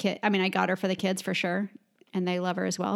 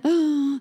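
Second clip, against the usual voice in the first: excited gasp; 7 semitones higher, 5 dB louder than usual. Real, not acted. Excited about Austin trip.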